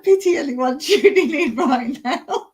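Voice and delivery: real amused cracking up; laughing while talking. Real, not acted. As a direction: squeeze the words out through laughter. Laughing at own failures.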